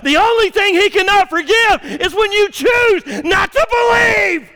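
rising from loud to a high shouting peak; building to full-force preaching. Acted. Pastor proclaiming forgiveness to the congregation, building to a shout. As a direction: build the climax until it rings. Sermon climax.

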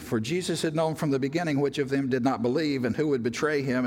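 ordinary talk

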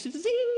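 very high comic sound effect; playful zing. Acted. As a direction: toss it off like a cartoon whoosh. Mock throwing peace like candy.

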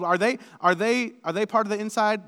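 usual speaking voice

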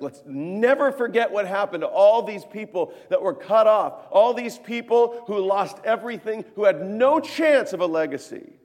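fervent high-volume pulpit delivery; impassioned preaching. Acted. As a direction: preach it out as an urgent plea. Preacher at full force.

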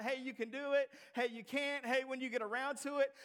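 mock careless permission; mock casual. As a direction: toss it off like it's optional. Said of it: "This is not".

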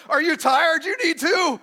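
loud, high call for the room to answer; call and response. Pretend. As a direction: shout it like a song leader wanting a reply. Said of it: "Play along".

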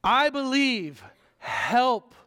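high, loud, slow anguished cry; father begs Jesus for his son, crying out. Retold or imitated. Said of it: "he cries out and say".

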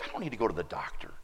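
muttered then rising complaint, brushing it off; mock grumpy self-sufficient grumble; grumpy dismissive attitude. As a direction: sound like you can't be bothered. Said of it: "so I get this attitude like".

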